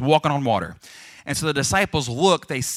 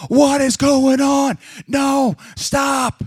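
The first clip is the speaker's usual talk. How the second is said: high, loud, drawn-out yell; panicked shouting; 12 semitones higher, 8 dB louder, much slower than usual. Acted. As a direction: yell as if trapped and being tipped over. Man trapped in tipping porta potty.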